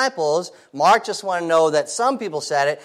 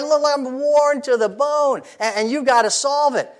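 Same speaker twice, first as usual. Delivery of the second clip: worn-out complaining Martha; 9 semitones higher than usual. Retold or imitated. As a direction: drag it out like she's exhausted. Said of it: "She's like".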